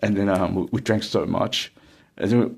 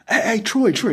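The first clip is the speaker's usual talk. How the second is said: high, raised call across the room; 13 semitones higher than usual. Retold or imitated. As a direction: shout "Troy" to get his attention. Calling out to Troy.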